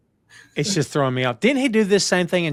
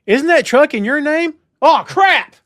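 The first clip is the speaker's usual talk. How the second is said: high, loud mock realization; panicked goon; 10 semitones higher, 6 dB louder than usual. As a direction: shriek it like a dim crook. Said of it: "one looks at the other".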